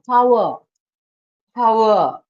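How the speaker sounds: "Power" as "pa-wuh", the final r dropped; soft R. Shown as a demonstration. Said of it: "stress on the R".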